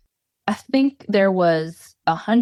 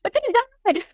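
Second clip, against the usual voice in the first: mock panic; 13 semitones higher than usual. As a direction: replay the terror of nearly dying, half laughing. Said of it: "And I'm like".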